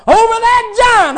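high, loud triumph; triumphant David. Pretend. David boasting of victory over the giant. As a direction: ring it out as victory.

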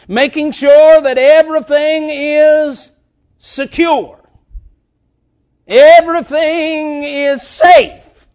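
loud, high, slow preacher's build; fiery preaching. Acted. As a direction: hammer home the warning. Preaching at full force.

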